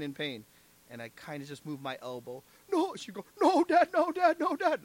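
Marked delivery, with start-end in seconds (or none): 2.7-3.0 high mimicked plea; quick high yelp, imitating someone in pain; imitated pained protest. Injured girl as he moves her knee, imitated pained protest. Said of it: "She was like that". 3.4-4.8 high, rapid-fire mimicked panic; imitated pained pleading. Injured girl begging as her knee is moved, imitated pained pleading. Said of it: "She was like that".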